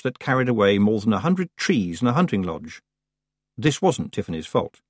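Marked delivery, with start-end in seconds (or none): none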